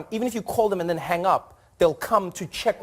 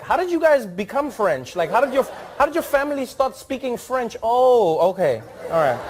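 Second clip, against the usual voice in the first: mock quizzical fan; 8 semitones higher than usual. Quizzing players on their roots.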